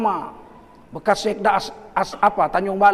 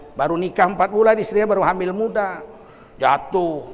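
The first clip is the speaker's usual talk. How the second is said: ordinary talk, nothing marked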